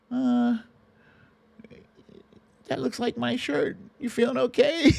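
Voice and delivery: mock mom, high-pitched and concerned; imitating mom. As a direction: squeak it out like a worried mother asking about her shirt. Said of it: "She didn't say".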